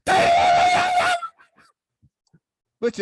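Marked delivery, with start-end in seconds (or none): 0.0-1.1 piercing, very high mother's shriek; hammed-up hysterical mother; mock calm scream. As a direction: scream the name long, high and loud. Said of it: "And she very calmly says".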